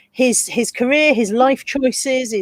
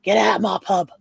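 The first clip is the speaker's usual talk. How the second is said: mock Cockney pub landlord; quick Cockney soap-opera line, kept restrained; EastEnders impression; 3 semitones lower than usual. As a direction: put on an EastEnders London accent. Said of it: "He sounds EastEnders".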